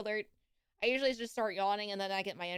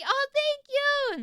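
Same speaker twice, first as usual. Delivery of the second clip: high, slow drawn-out thanks; high stretched happy thank you; delighted thanks; 9 dB louder than usual. Real, not acted. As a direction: gush with thanks. Flattered by compliment.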